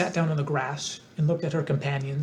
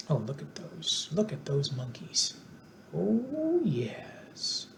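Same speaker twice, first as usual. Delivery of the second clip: soft aside; 2 semitones higher, 6 dB quieter, much slower than usual. Real, not acted. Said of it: "aside to listener".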